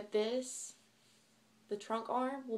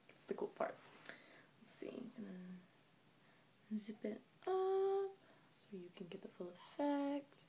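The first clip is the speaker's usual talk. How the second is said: hushed whisper building suspense; 7 dB quieter, slower than usual. Real, not acted. Teasing viewers with a whispered hoodie reveal.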